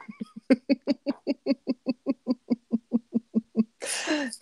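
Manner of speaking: long high, soft laugh trailing on; real drawn-out giggle fit; helpless giggling. Real, not acted. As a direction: laugh till the breath runs out. Cracks up reading live chat.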